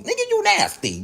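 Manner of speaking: a high, appalled cry of gross; high disgusted exclaim. Real, not acted. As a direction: squeal your disgust at her. Disgust at the drink.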